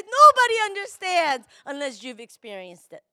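loud, crying plea; tearful plea. Real, not acted. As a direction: cry out that nobody understands. Preaching climax, tearful.

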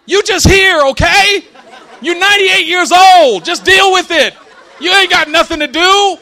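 put-on loud, high exasperated yelling at an old aunt; fed-up outburst. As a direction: lose patience and shout it at her. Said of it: "Finally, I got tired of it".

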